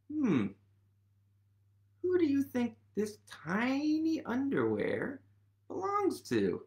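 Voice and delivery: usual speaking voice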